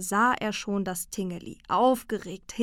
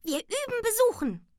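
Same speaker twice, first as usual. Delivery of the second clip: very high, squeaky little creature voice; rufendes Tingeli. A narrator's reading. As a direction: call out in a tiny high voice. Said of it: "rief es". (hohe Piepsstimme, rufend)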